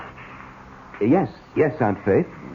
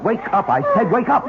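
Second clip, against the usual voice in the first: commanding shout; 11 semitones higher than usual. Acted. Husband shouts at the sleepwalking girl to wake. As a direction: bark her awake.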